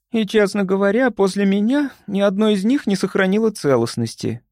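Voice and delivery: high, rueful admission; groaning confession. A narrator's reading. Лили admitting she ate every pastry, plaintive groan. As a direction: own up plaintively. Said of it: "со стоном призналась Лили".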